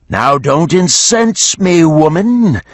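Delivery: a beast on the edge of anger; irritated dragon. A narrator's reading. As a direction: dragon warning Geneva. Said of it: "the worm said".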